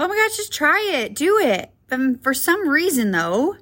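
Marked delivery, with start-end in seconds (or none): 0.0-1.7 high-pitched, eager urging; pep-talk push, pitched well up; encouraging. Acted. Urging ourselves to try something new, encouraging. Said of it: "we might encourage ourselves".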